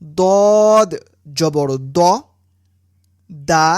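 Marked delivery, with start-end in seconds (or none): 0.0-2.0 lips round and full on দদ জবর দ; rounded heavy dad. Shown as a demonstration. Teacher drills দদ with a rounded mouth. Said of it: "মুখ গোল করে উচ্চারণ করতে হয়".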